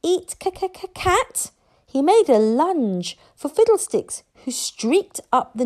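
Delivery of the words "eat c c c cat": high stuttering creature voice; little dragon. A narrator's reading. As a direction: stammer it as a hungry little dragon. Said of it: "said Toothless".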